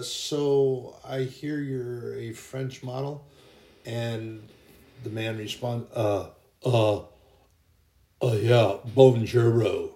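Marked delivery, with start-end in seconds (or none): 0.0-3.2 very slow, put-on doubting man's voice; skeptical coworker. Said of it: "and he goes". 5.9-9.9 hesitant, very slow fake French accent, louder on bonjour; bad French accent. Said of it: "it's not even good French".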